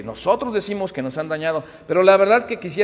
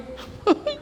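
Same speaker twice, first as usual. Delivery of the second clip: surprised laugh. Real, not acted. Laughs at grandfather's remark.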